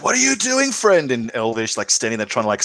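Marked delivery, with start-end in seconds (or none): 0.0-1.1 high-pitched in-character protest; acted cry of defiance to a friend; defiant cry. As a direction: cry out high, as if challenging a traitor. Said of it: "I'm screaming in defiance".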